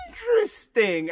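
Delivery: high, loud, drawn-out imitation; teacher's exaggerated intrigue. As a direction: stretch it out in hammed-up fascination.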